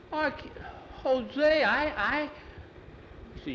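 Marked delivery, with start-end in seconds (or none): 0.1-2.3 high, stammering start; flustered stammer. A narrator's reading. Jackie stammering, high and flustered, before the boss. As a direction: trip over the words in surprise.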